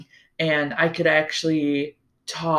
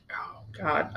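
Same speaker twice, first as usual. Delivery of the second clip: whisper; 11 dB quieter than usual. Real, not acted. Recalling distress, aside.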